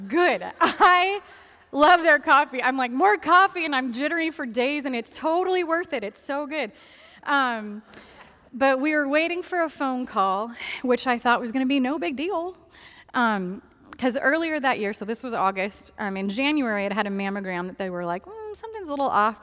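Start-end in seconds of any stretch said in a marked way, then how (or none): 2.9-3.6 loud, high mock demand for more; eager demand. Acting out craving for coffee. 18.2-19.4 hedging doctor. Retold or imitated. Voicing doctors softening bad news.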